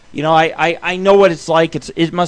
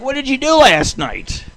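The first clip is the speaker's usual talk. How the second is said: mock friend's question; 10 semitones higher, 5 dB louder than usual. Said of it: "it's like".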